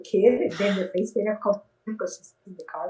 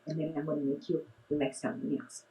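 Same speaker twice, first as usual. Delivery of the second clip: quiet to his ear; 5 semitones lower, 8 dB quieter than usual. Retold or imitated. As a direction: murmur it close to a child's ear. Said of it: "to his ear".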